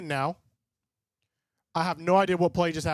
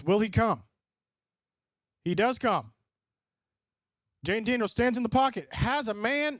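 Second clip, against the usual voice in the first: lightly voiced game commentary; play-by-play call; 2 semitones higher than usual. Streamer calling the game live for chat, a light play-by-play. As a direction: call the snap like a broadcaster.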